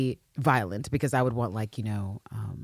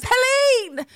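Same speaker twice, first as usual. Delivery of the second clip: very high, loud mock scream of a name; 5 dB louder than usual. Host re-enacts two weeks of mispronouncing a name, screaming "Helene". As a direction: belt out "Helene" at the top of your lungs. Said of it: "screaming at the top of my lungs".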